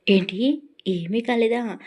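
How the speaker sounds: loud, startled disbelief; surprised. A narrator's reading. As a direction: blurt out in shock. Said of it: "ఆశ్చర్యంగా అడిగేశారు". (ఆశ్చర్యంగా అరుపు)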